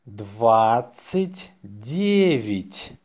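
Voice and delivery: slow, drawn-out dictation. Shown as a demonstration. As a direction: say it slowly for learners to write. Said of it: "say it slowly".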